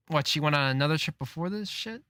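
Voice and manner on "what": ordinary talk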